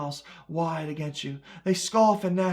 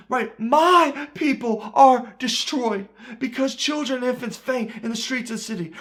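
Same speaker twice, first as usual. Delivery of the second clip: usual speaking voice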